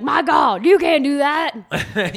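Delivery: hammed-up announcer shock; mock commentator. Commentators over daily life, mock outraged call. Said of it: "and they're like".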